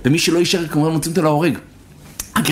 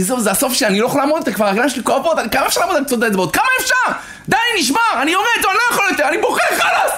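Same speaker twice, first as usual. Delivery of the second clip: climbs from strained to a very high cry; a child at breaking point, pitch rising to the end; mounting despair; 9 semitones higher, faster than usual. Retold or imitated. Boy breaking down under the strain. (ייאוש גובר עד זעקה גבוהה)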